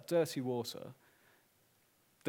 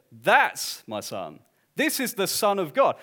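proclaiming Father; 5 semitones higher, 7 dB louder than usual. Acted. Preacher voicing the Father's declaration.

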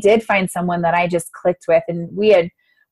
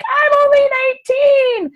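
much higher, slower indignant squeak; higher drawn-out little sister protest; incredulous protest. Retold or imitated. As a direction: protest that you're far too young. Retorting to her brother.

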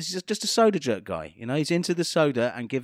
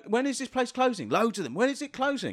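higher, repeating impatient punter; whiny punter voice; 8 semitones higher than usual. Retold or imitated. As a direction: nag like an impatient clubber. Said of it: "They kept coming up and going".

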